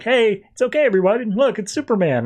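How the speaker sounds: high, upbeat group reassurance; mock excited announcement; excited kids. Kids cheerfully announce Superman's arrival.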